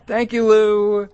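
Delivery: slow, high, savouring the punchline after a laugh; amused, drawn out. Real, not acted. Host repeating a caller's punchline, amused and drawn out. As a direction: stretch each word out, still tickled.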